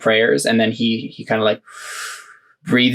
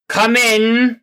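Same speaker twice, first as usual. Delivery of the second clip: calling out; 12 semitones higher than usual. Acted. Inquisitor calls someone in.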